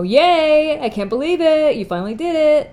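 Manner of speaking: put-on high, loud gushing Valley Girl voice; over-the-top Valley Girl cheer. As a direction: squeal it like a thrilled Valley Girl. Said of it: "I'm going into that Valley Girl thing".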